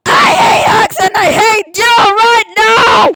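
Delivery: high, loud, tearful yell; angry crying shout. Acted. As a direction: scream it through tears. Angry outburst at hug offer.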